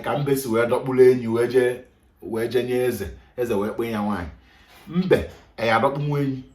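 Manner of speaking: normal talking voice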